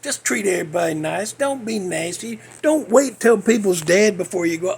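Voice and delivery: normal talking voice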